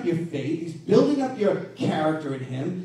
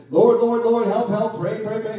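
mock frantic prayer; 2 semitones higher, 7 dB louder than usual. Mock babbling prayer to God.

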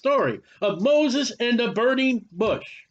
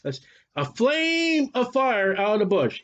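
ordinary delivery

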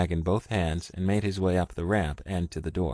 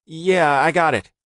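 higher, louder, shaky with fear; scared but trying to sound fine; fearful; 10 semitones higher, 9 dB louder than usual. A narrator's reading. Richard answering Ross, fear in his voice. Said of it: "the sound of fear in his voice".

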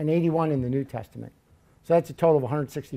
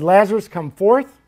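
raised, louder calling voice; loud cry; 4 semitones higher, 7 dB louder than usual. Retold or imitated. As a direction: call it out with more force and lift. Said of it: "loud voices".

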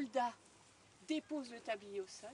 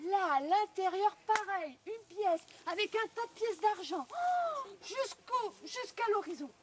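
louder, higher amazed telling; excited wonder; 6 semitones higher, 9 dB louder than usual. A narrator's reading. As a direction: marvel at the silver. Marvels at silver.

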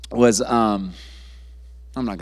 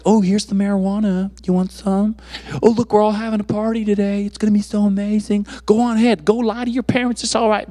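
higher-pitched mock tempter; voice of temptation; 7 semitones higher than usual. The world tempting a young believer, high mock coaxing. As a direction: coax like a peer offering a party. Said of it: "The world's like".

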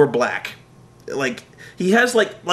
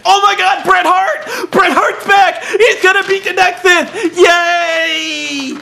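very high, loud giddy shouting; mock fanboy cheer; 14 semitones higher, 6 dB louder, slower than usual. Mocking the show's big reveal, high loud giddy shouting. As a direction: squeal like a kid at the show.

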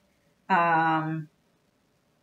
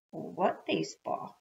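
feigned innocence; 11 dB quieter than usual. Acted. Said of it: "I asked innocently".

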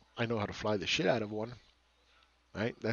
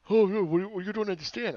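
mocking; 8 semitones higher, 4 dB louder than usual. Mocking a critic's reply.

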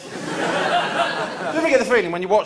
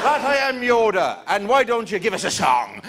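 put-on Irish accent; 4 semitones higher than usual. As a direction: play Liam Neeson as a cheeky Irishman. Qui-Gon replies Irish.

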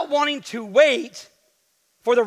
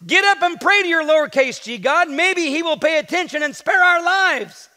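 shouted; 6 semitones higher, 4 dB louder than usual. Acted. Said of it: "he shouted".

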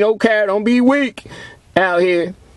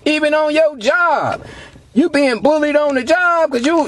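ordinary talk, nothing marked

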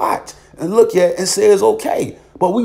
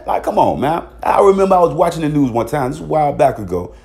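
normal talking voice

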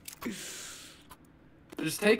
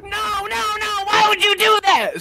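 very high-pitched fake whine; hammed-up high cry of protest; mock wailing; 5 dB louder than usual. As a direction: wail it high, getting louder. Mocking the teammate's complaint.

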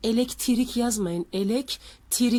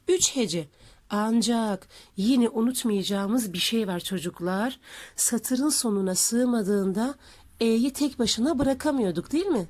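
ordinary talk, nothing marked

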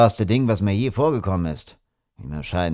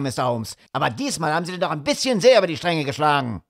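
fast, higher, hot-tempered rant; furious; 6 semitones higher, faster than usual. A narrator's reading. Sir Henry rants at Holmes about his shoe, fast and furious. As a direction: rattle off the threats in a rage. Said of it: "Er war so wütend".

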